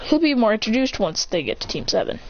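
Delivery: plain reading voice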